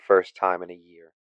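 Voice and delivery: ordinary delivery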